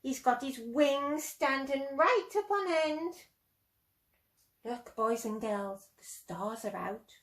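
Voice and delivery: ordinary delivery, nothing marked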